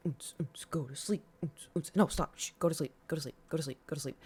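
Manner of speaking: soft, lulling hush to sleep; whispering over a crib. Retold or imitated. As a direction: shush yourself gently like a baby. Hushing herself to sleep.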